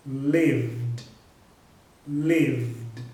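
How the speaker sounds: "lived" as one syllable ending in VD; low, drawn "lived", voiced V straight into D. Shown as a demonstration. Teacher modelling the third rule, "lived" ending in D. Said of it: "com som de D".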